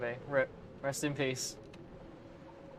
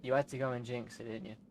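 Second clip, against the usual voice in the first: muttered blame; 3 semitones lower, 12 dB quieter than usual. Real, not acted. Blaming self for jinx.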